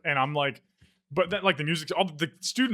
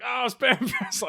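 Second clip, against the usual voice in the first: cop's amused echo; 10 semitones higher, much slower than usual. Retold or imitated. Cop echoes his line back.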